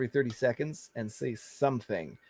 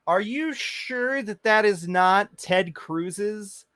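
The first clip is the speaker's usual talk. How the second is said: slow teasing question; 6 semitones higher, slower than usual. Real, not acted.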